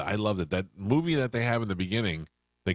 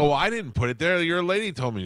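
higher, louder indignant pushback; indignant protest; 9 semitones higher, 5 dB louder than usual. Retold or imitated. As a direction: protest the unfair blame, voice jumping up. Arguing with staff over moved cake.

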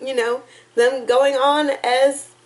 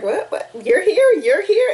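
higher, louder startled double take; mock the stunned band members realizing they're together; surprised characters; 7 semitones higher, 4 dB louder than usual. Retelling the video's ending with a surprised, raised voice. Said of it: "Everyone's just like".

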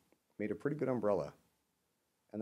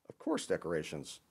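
usual speaking voice

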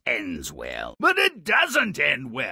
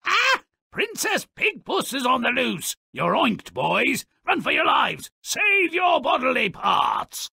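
mock panicked character; 11 semitones higher than usual. Character warning the brothers to run, high comic panic. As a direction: squeal a warning like a cartoon alarm.

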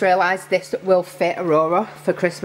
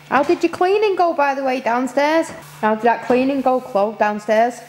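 calling out; 7 semitones higher than usual. Real, not acted. Calling out to Chloe downstairs.

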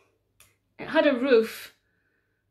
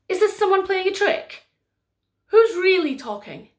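astonished Mary; 8 semitones higher, 7 dB louder than usual. Acted. Mary astonished by talking chair.

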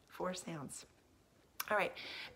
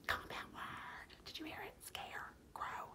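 whispered hint; 13 semitones higher, 13 dB quieter than usual. Real, not acted. Teacher letting pupils in on a compound word, whispered.

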